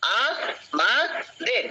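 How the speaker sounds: আ, মা, দের in three separate pushes; teacher repeating the syllable split. Shown as a demonstration. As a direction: beat out আ মা দের. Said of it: "তিনবার ধাক্কা দিতে হচ্ছে".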